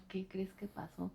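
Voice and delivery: very soft, confiding mock gossip; hushed gossip. Friend passing on gossip afterwards, hushed confiding. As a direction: drop right down as if sharing a secret.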